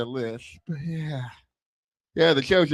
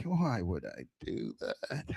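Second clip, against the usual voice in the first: lower, much softer, slow echo; echoed aside; 9 semitones lower, 11 dB quieter than usual. Shown as a demonstration. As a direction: drop it low and slow like an echo. Rhetorical punchline aside.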